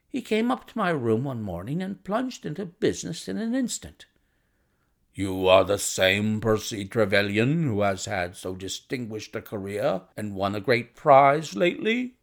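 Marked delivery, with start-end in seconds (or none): 5.1-12.1 put-on blunt visitor sizing up a young doctor; brusque visitor. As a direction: play a forthright man plunging into business. Narrator voices the visitor Blessington.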